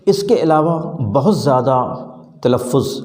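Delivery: normal talking voice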